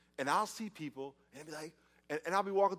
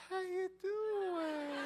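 very high, drawn-out cooing; hammed-up baby talk, soft and high; talking to toddler; 5 dB quieter, much slower than usual. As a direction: coo sweetly to a little child. He answers the small child.

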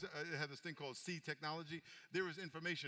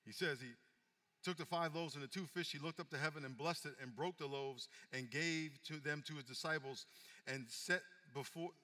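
usual speaking voice

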